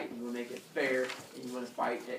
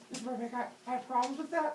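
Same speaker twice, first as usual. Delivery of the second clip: high-pitched imitation of a pupil; objecting student; 11 semitones higher, faster than usual. Teacher imitates student objecting to the story, high mock voice. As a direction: mimic a fussy complaint in a high voice.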